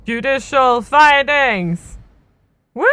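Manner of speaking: drawn-out, hyped title call; playful announcement. Pretend. Player calling out the game's next phase, playful announcer. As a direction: announce it slowly with mock grandeur.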